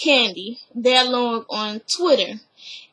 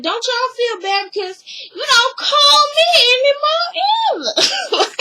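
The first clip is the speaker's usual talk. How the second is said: mock guilt trip; 9 semitones higher than usual. Mocking Mama Joyce guilt-tripping Candy, high and whimpering. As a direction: drag it out like a wounded mother.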